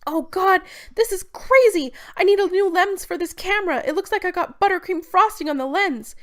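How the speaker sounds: high, loud frantic exclaiming; frantic excitement. Acted. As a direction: yelp it out over the chaos. Jem amid chaos and tear gas.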